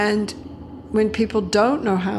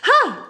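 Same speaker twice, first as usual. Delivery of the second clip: high, loud little breath of relief; sudden high "huh" of a child let off the hook; relieved kid's "huh"; 13 semitones higher, 5 dB louder than usual. Retold or imitated. Kid reassured by the mother, relieved "huh". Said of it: "And the kid goes".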